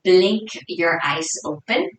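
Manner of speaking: soft, hushed meditation guidance with long pauses; guided meditation. Real, not acted. Yoga teacher settling the class into their breath, soft guided meditation. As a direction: ease them inwards, softly and unhurried.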